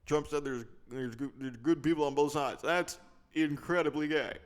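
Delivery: mock statesman pronouncing judgment from a podium; Barack Obama. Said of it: "it's Barack Obama".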